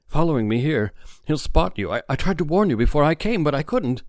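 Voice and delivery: Harry's alarm pitched high and fast; panicked warning. A narrator's reading. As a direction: blurt it out in a frightened rush.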